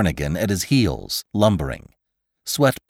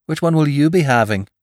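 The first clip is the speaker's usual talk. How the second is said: light, quick, higher-pitched question; breezy, offhand offer; casual; 7 semitones higher, faster than usual. A narrator's reading. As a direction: toss it off casually. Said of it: "asked Irish casually".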